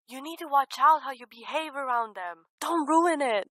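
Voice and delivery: nagging inner voice. Retold or imitated. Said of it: "this little voice in the back of my head".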